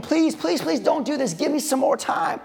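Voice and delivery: high pleading please please; begging. Pretend. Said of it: "I would be begging".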